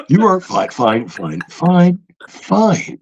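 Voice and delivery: glitching phone bot, stuck on repeat; mock automated voice with dropping, jumping pitch; computer voice. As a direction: stutter like a broken recording. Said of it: "is a computer voice".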